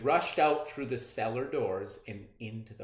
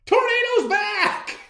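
high, loud, drawn-out holler; elated shout; 12 dB louder than usual. Acted. Child telling daddy the dog is back, joyful yell. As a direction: holler the great news.

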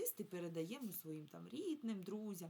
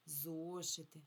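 "Зошити" with the о drawn out; stress on first syllable; slower than usual. Shown as a demonstration. Teacher confirming the answer, first syllable stressed in "Зошити". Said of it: "На перший, так".

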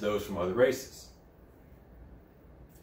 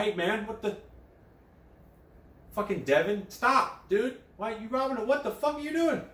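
much higher, alarmed bro voice; mock panicked coworker; 12 semitones higher than usual. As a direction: yelp at your robbing buddy. Imitating coworker stopping cop.

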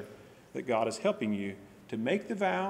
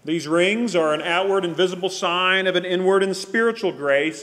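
loud, raised solemn prayer voice; praying aloud; 4 semitones higher, 10 dB louder than usual. Acted. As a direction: proclaim the prayer to the whole room. Ring blessing and prayer.

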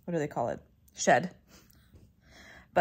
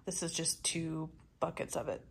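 whispering; 3 semitones lower, 7 dB quieter, slower than usual. Real, not acted. Aside whisper about decorations.

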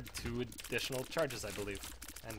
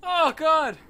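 ecstatic screaming joy, much higher; relieved laughter; 15 dB louder, slower than usual. Real, not acted. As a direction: yell it out in pure relief. Ecstatic after surviving hard fight.

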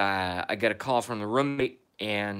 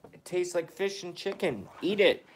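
pet talk; 8 semitones higher than usual. Real, not acted. Coaxing cat to eat medicine.

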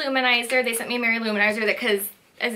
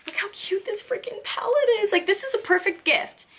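much higher, delighted gushing; 10 semitones higher than usual. Real, not acted. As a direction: squeal over how cute it is. Gushing over cute palette.

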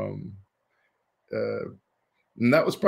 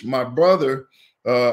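ordinary talk, nothing marked

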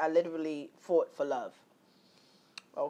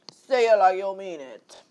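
loud, slow, diva talk-show drawl; Wendy Williams impression; 9 dB louder than usual. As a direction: ham it up like a sassy TV host. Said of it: "Like Wendy Williams".

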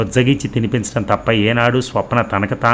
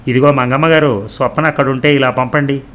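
raised, higher-pitched call; lightly acted hail, louder than narration; 5 semitones higher, 4 dB louder than usual. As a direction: call across to the cook with a request. Madhavi calls to the cook.

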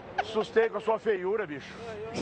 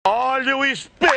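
higher, louder hawker's cry; street vendor call; 4 semitones higher, 10 dB louder than usual. Acted. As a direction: call out your skewers to the street. Skewer vendor calling out.

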